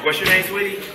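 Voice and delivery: light Trinidadian lilt, flirty; a hint of island accent chatting up a lady; Caribbean accent. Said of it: "I can do the accent".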